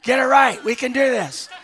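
loud, high pep-talk shout; fired-up exhortation, punched out loud; urgent rallying shout. Acted. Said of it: "shake you a little bit and say".

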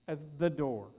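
ordinary talk

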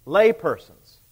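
"Lay persons" said slowly, leaning on "Lay"; deliberate slow stress on "Lay persons"; slow emphatic stress. Shown as a demonstration. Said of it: "notice who comes first in the list".

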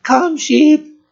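put-on small child's high-pitched sheep call; squeaky five-year-old yell. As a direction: imitate a little girl hollering to sheep. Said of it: "in her squeaky little five-year-old voice".

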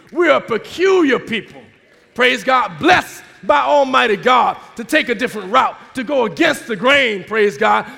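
loud, driving sermon delivery; loud, punchy exhortation; forceful preaching. Real, not acted. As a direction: preach it hard to the congregation.

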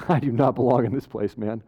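humbly grateful murmur with a chuckle; humbled gratitude. Retold or imitated. Saved sinner in heaven humbly marvels, quietly grateful. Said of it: "spending all of eternity going".

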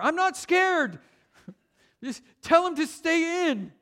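put-on high, loud protesting child; kid yelling. Little brother yelling back at mom, high and loud. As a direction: insist you're not scared, half laughing. Kid denying fear, shouting.

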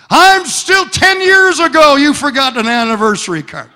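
high, loud imitation of a complaining wife; nagging wife. Preacher mimicking his wife's old grievance, high and loud. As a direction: whine it way up like an aggrieved spouse.

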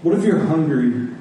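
ordinary talk, nothing marked

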